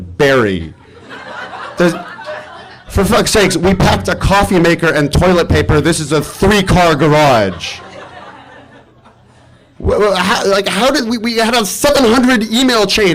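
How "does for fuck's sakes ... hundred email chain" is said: high, laughing disbelief; incredulous outrage. Retold or imitated. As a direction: squeak out the outrage through laughter. Arriving at the wrong house, disbelieving.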